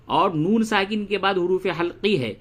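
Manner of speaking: ordinary talk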